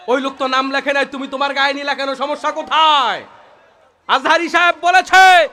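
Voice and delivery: normal talking voice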